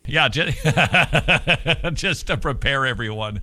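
chuckling through the words; laughing. Real, not acted. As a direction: laugh while teasing a friend. Host laughs teasing guest.

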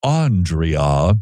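"Andrea" stretched slowly as AHN-dree-AH; drawn-out Andrea. Shown as a demonstration. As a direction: draw out Andrea with broad open ah sounds. Teasing with exaggerated pronunciation of name.

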